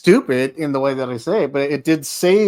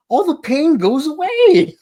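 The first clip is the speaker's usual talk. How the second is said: very high, drawn-out joking lilt; comic high-pitched relief; playful sing-song; 4 dB louder, slower than usual. Pretend. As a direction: float it up high and stretch it out.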